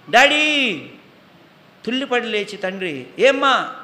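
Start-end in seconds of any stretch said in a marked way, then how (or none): 0.1-0.9 high, loud call for daddy; child's cry. Acted. Child screams for father at night. 3.2-3.7 high, loud startled answer; startled reply. Acted.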